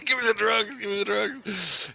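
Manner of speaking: very high-pitched mock begging; mock pleading. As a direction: beg for it in a squeaky-high put-on voice. Acts out begging for drugs.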